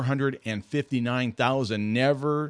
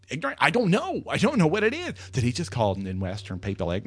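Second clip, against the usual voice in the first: normal talking voice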